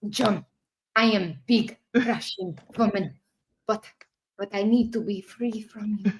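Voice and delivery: mock Russian woman, softening at the end; Russian accent. Second try at reading the message to John, mock Russian accent. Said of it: "can I read this in a Russian accent".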